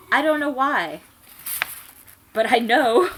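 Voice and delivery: over-the-top dramatic read; hammed-up melodrama. A narrator's reading. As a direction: play it like a soap opera. Dramatic reading of fan fiction.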